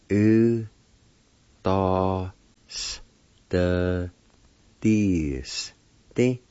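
"U, da, s, the, dis, di" with long gaps; syllable by syllable. Shown as a demonstration. Teacher showing how penalty is said, syllable by syllable. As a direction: sound out each syllable slowly. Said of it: "This pronounced like this".

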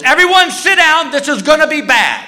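high, panicked mock announcement; freaking out. Flight attendant warning passengers in turbulence, freaking out. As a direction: blurt it high, in comic panic. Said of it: "the flight attendant freaks out".

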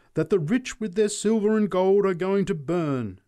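high, loud hellfire warning; fervent preacher at full cry; shrieked. A narrator's reading. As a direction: shriek damnation at the crowd. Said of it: "she shrieked".

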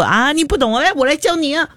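high-pitched, over-keen offer to teach; mock eagerness to instruct; eager self-appointed teacher. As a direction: pitch it way up like a pushy know-it-all helper. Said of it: "主动去教人".

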